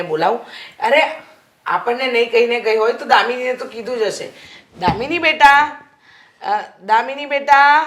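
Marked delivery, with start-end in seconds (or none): 4.8-7.8 calling out. Acted. Mother calls daughter from another room.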